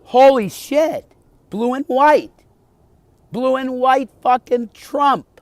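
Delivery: very high, loud, slow shouting; excited yelling. Real, not acted. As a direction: shout in disbelief at the plane. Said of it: "Why is he so freaked out?".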